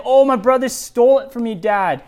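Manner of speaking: high, loud, whiny mock self-pity; mock Esau as a whining victim; hammed-up sulky whine to Dad; mock helpless victim. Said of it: "So here he's saying".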